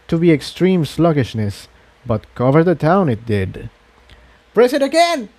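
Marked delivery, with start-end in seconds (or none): 4.5-5.3 much higher, urgent cry; Tiptoe shouting. Acted. Tiptoe shouts at Incendia to press again, high and urgent. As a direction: yell the order at the button. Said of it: "Tiptoe shouted".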